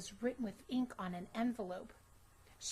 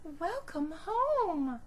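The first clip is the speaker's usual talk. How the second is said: slow, loud, higher greeting; warm mother; 8 semitones higher, 7 dB louder, much slower than usual. Acted. Mother greeting her daughter home, slow warm call. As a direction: sing out a welcome.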